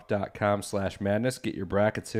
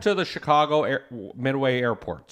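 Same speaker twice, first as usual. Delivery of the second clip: put-on Chicago accent; 2 semitones higher than usual. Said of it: "That's a Chicago accent".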